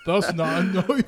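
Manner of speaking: laughing fake Australian twang; mock Australian accent. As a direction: echo it in a hammed-up Australian accent. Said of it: "That's Australian".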